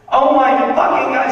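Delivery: put-on high, loud teen tantrum; screaming teen. Comic sketches teen screaming at her mother, high tantrum. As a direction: throw a bratty tantrum. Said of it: "she's screaming at her mother".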